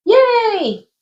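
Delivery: high squealed yay; excited cheer. Real, not acted. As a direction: cheer the group on, bright and high. Cheering after giving the homework.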